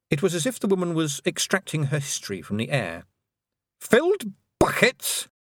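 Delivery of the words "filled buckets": slow, high probing question; stern old witch. Acted. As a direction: read her past aloud. Weatherwax questioning.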